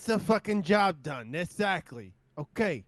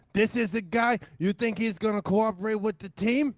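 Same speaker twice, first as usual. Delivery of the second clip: louder, higher outraged rant; indignant shout; 7 semitones higher, 9 dB louder than usual. Acted. Bear complaining to Peaches about the new guy, getting louder.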